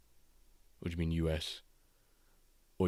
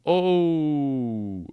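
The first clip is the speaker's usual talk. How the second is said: surprised; 8 semitones higher, 8 dB louder than usual. Real, not acted. Big shocked exclamation.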